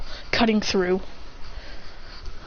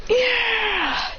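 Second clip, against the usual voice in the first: mock cheer; 12 semitones higher than usual. They all have fun sliding, mock cheer. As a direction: pretend group yell of fun.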